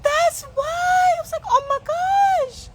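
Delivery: high, loud, slow wail; mock distressed wail. Retelling her trauma after the exam, high slow wail.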